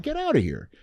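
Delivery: a high squeak of mock disbelief. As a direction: pretend you can't believe it. Disbelief at wife's news.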